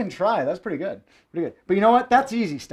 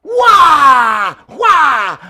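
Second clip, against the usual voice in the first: loud high mock yell "wah"; two big diva shouts; sassy wah shout; 7 semitones higher, 12 dB louder than usual. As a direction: belt out a silly battle cry. Sassy step flourish shouts.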